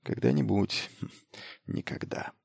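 very soft, fading to a whisper; private murmur. A narrator's reading. Miles after promising to catch up, fading murmur. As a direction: let it sink from hushed to voiceless.

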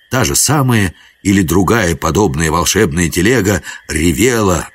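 ordinary delivery, nothing marked

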